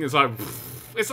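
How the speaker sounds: usual speaking voice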